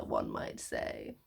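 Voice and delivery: one might say, dropped low and slow; a wry, deep, slow aside; dry low aside. Shown as a demonstration. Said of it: "repeated tag line".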